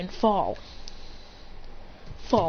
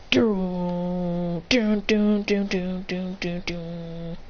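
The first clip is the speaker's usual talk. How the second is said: doom chant trailing off to nothing; ominous chant fading out; 2 semitones lower, much slower than usual. Retold or imitated. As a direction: chant doom and let it die away. Chanted sound effect activating power.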